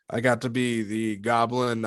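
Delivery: ordinary delivery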